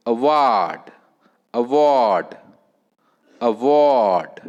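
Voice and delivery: "award" with WARD loud, long and falling; stress on second syllable. Shown as a demonstration. Teacher repeating fourth word for students, "award" stressed on WARD. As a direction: swell hard on the WARD of "award". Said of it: "stress on the second syllable".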